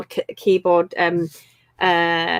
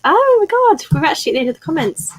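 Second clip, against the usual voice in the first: excited; 8 semitones higher, 4 dB louder than usual. Real, not acted. Reaching the end of comments.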